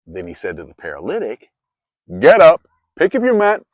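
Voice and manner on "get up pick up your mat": loud, high command to rise; commanding call. Acted. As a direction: call him up from his mat with force. Jesus commands the paralytic.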